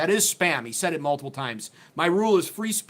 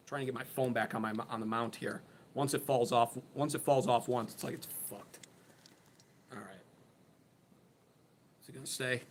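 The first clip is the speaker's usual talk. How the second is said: much softer, lower, to himself; muttering aside; 4 semitones lower, 9 dB quieter than usual. Real, not acted. Streamer fixing his fallen phone, muttering softly to himself. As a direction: mumble while fiddling with the phone mount.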